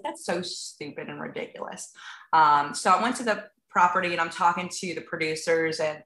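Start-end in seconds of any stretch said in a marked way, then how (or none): 0.0-1.9 much softer, dismissive aside; muttered self-scolding. Retold or imitated. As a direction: talk herself down under her breath. Scolding herself quietly.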